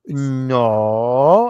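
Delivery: long, loud "no" imitating a Polish accent. Tango teacher catching a student's wrong step, imitated Polish accent. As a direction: scold like a Polish dance teacher, drawn out. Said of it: "in her Polish accent".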